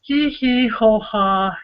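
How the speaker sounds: slow spelled-out hee hee ho ha; drawn-out chanted chuckle; slow recited laugh. Acted. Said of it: "And it starts off as".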